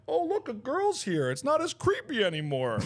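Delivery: performed sing-out needling; mocking taunt. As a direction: goad them with a put-on delighted discovery. Goading the men further.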